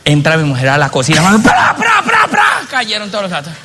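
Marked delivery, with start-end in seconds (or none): 1.2-3.5 crash sound effect. Acted. Imitating crash of dishes.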